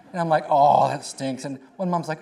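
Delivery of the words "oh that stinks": louder, disgruntled aside; hammed-up disappointment; mock grumble. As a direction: grumble it loudly like a sore loser. His deflated reaction quoted.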